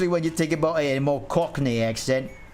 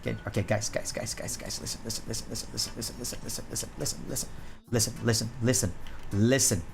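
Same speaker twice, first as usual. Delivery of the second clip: whispering; 6 semitones lower, 8 dB quieter, faster than usual. Real, not acted. Hushed repeated calling to viewers.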